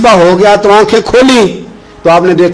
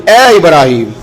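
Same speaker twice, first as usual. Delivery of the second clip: ordinary talk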